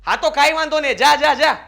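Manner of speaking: angry husband snaps at his wife to just go; angry dismissal. Acted. Husband angrily sends wife away. (ગુસ્સામાં જોરથી કાઢી મૂકવું)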